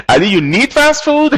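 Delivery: high, laughing "you need fast food?"; laughing question. Real, not acted. Teacher laughing at a student's sentence, high and amused. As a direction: chuckle through the question in disbelief.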